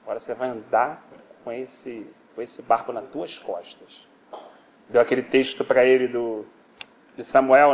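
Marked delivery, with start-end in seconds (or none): 0.0-4.1 low, halting pronouncement; stern divine sentence. Acted. God sentencing the brother to carry his boat, low and measured. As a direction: lay down the penalty low, dragging the final words. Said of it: "Deus falou".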